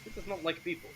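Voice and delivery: light, dismissive foreigner in broken Common; lightly voiced Sergei. Sergei warns an adventurer about the Count, lightly accented and dismissive.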